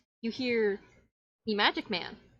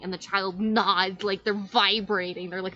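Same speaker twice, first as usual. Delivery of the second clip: normal talking voice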